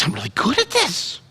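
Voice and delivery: high, soft mock wife voice; wife's high voice. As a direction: lift into a high, hushed wife imitation.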